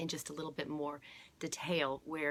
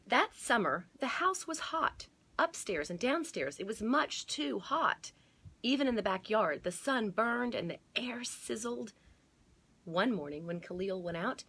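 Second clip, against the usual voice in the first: animated read-aloud, higher and louder; story narration; 4 semitones higher, 4 dB louder than usual. A narrator's reading. Librarian reading to children with dramatic lift. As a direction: paint the hot summer for the kids.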